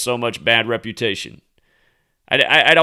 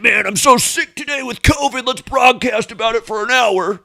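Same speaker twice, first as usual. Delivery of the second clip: hammed-up sing-song whine, much higher and louder; mock sick newscaster; 10 semitones higher, 4 dB louder than usual. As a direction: ham up a fake-sick TV host. Mocking brother on CNN.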